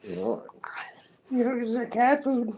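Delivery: high, contented pampered pet; spoiled pet voice. Pretend. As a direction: speak as a pet basking in attention.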